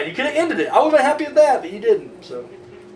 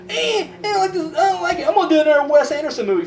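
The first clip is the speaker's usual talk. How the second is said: whiny, much higher mock refusal; Bill Murray; 8 semitones higher than usual. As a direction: play a reluctant actor griping in a silly high voice. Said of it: "Fuck you, Bill Murray".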